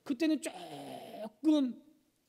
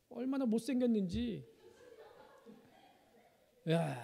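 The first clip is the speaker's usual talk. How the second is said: usual speaking voice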